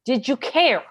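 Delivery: a lawyer yelling at a witness who dodges the question; angry shout. Acted.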